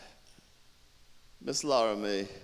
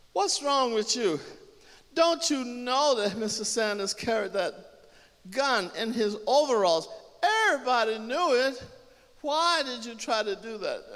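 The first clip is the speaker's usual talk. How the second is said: loud, high reproach in grief; anguished lament; 11 semitones higher, 6 dB louder than usual. Acted. Woman scolding dead Leon, anguished lament. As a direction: plead loudly with the dead. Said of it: "She lamented".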